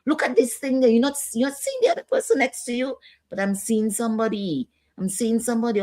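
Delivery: high, insistent voice of someone hearing things; agitated patient. Acted. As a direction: play a distressed person with delusions. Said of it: "making them get aggressive and, and say".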